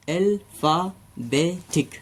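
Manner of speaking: "Alphabetic" धीरे: "अल… फा… बे… टिक"; "alphabetic" का हर सिलेबल अलग; चार हिस्सों में. Shown as a demonstration. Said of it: "चार हिस्सों में हमने बोला". (शिक्षक की तरह "अल्फाबेटिक" टुकड़ों में)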